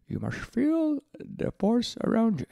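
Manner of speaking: high, slow squeezed little alien voice; Yoda impression. Quoting Yoda to listeners, high slow Yoda voice. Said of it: "Or perhaps Yoda".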